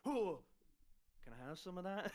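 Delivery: put-on slurred drunk asking for drink; groggy drunk begging for a sip. GM voices woken drunk.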